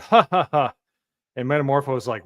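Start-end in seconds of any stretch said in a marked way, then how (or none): 0.0-0.7 louder, higher mock villain laugh; hammed-up taunting ha ha ha. The men mock Metamorpho with a hammed-up laugh. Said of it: "and they're like".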